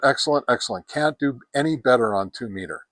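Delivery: ordinary talk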